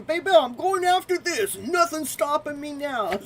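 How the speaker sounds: high, loud and pumped up; gleeful battle cry to yourself; fired-up declaration. Retold or imitated. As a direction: shout it like nothing can stop you. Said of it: "and going".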